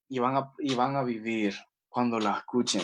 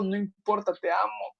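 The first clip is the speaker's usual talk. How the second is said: gentle answer of the Lord; 7 semitones higher, 4 dB quieter than usual. Pretend. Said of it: "le querés decir".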